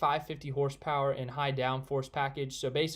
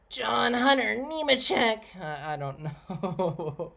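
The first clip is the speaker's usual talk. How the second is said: slow, drawn-out announcer voice, the name called higher and louder; Vince Welch impression; 7 semitones higher, 5 dB louder, slower than usual. Streamer mocks a commentator with a slow, drawn-out announcer call. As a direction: ham up a slow, overblown booth call. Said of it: "I'm gonna try to do a Vince Welch impression".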